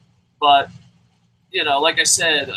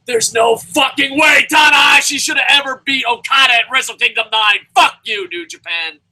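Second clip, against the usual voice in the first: loud, higher furious rant; angry rant; 7 semitones higher, 7 dB louder than usual. Real, not acted. Ranter on his deathbed list, loud angry rant. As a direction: rail against the result.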